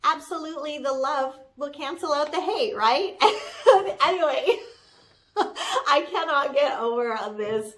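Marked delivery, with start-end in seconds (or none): 3.2-4.0 bright laugh. Real, not acted. Laughs after joke.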